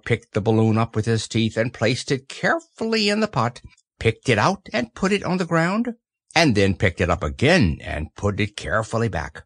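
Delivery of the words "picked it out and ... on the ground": ordinary delivery, nothing marked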